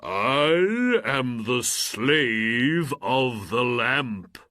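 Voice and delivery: slow, loud, grand genie voice; booming genie. Acted. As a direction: announce yourself like a mighty spirit. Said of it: "cried the genie".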